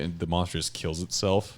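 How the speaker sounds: ordinary talk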